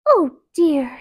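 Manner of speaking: a little gasp of alarm; startled oh. A narrator's reading. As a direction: princess waking among strangers.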